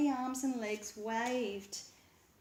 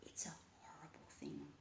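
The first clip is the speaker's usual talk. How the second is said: whispery, frightened skeleton; scared whisper; 4 semitones lower, 13 dB quieter than usual. A narrator's reading. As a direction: hush it out in fear. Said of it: "his voice whispery and a bit scared".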